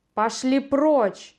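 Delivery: slow, loud furious shout; furious yell. Acted. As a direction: bellow it out in rage. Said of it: "в ярости заорал Антигон".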